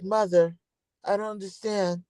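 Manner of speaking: a hushed, high-pitched plea of innocence. Acted. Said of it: "In my best M J voice".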